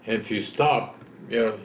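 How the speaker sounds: ordinary talk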